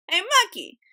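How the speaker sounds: high, loud holler up a tree; acted crocodile shout; calling out. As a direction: hail someone above you. Crocodile calls up to monkey.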